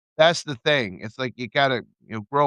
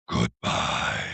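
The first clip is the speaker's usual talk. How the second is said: low rasping farewell, breathy and dark; deep gravelly voice; 14 semitones lower, 4 dB quieter than usual. Pretend. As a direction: growl it like a shadowy villain. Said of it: "Doing some kinda deep, gravelly voice".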